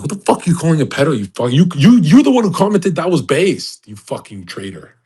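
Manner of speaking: loud, high and fast mock fury; mock angry rant. Imitating a ranting chat user, loud, high and fast. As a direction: rant back like an offended viewer.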